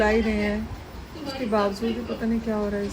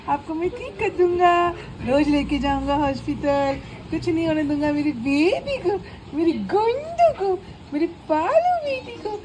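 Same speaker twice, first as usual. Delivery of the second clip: cooing pet talk; 5 semitones higher than usual. Real, not acted. Mother coos to her sick pet. (बच्चे से तुतलाती, ऊँची लाड़ भरी आवाज़)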